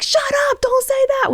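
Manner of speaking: put-on high, hissing mom shushing her child; imitating a mom hushing her child, pitched high; embarrassed mom. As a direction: mimic a mortified mother hushing her kid. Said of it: "Don't be that mom that's like".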